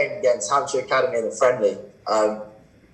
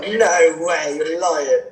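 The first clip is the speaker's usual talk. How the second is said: drawn-out, higher protest, half laughing; mock disbelief; 4 semitones higher, much slower than usual. Cricketer to a supposed prank caller, amused disbelief. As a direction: refuse to believe the caller, playfully.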